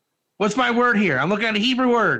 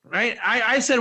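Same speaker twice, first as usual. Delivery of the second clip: ordinary talk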